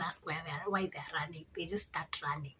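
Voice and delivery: hushed whisper. Real, not acted. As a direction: drop to a whisper. Whispered aside about running.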